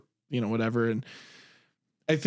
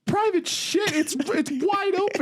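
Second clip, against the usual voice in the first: very high, slow, incredulous; Ted voice; slower than usual. Retold or imitated. Ted retorts that the files are wide open, high incredulous squeak. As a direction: squeak back in high disbelief. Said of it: "He's like".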